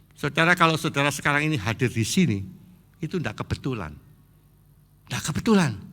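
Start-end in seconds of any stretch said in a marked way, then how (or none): none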